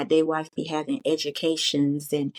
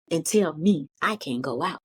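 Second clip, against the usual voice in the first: ordinary talk, nothing marked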